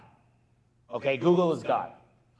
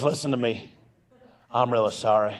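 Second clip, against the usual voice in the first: low, lightly imitated hillbilly voice; retold hillbilly apology, pitched down; hillbilly accent; 5 semitones lower than usual. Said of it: "he's got this really hillbilly accent".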